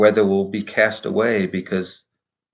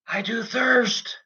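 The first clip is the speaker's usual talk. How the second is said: weak cry of thirst; 10 semitones higher, 5 dB quieter, slower than usual. Acted. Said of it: "as the man they call Jesus cries out".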